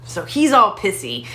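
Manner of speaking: ordinary delivery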